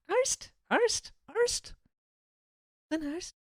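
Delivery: high, soft Scouse tries at "arst"; rising, tentative mock Scouse; practising Scouse. Shown as a demonstration. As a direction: test the Scouse word in a high questioning voice. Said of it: "I think I messed up the arsed".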